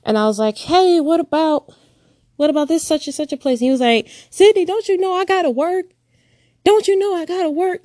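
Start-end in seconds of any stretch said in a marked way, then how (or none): 0.6-3.6 put-on hopeful child. Child reminding father of promise. 4.3-7.8 dismissive father. Retold or imitated. Father brushing her off.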